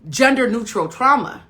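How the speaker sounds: ordinary delivery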